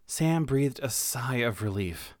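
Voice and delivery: plain reading voice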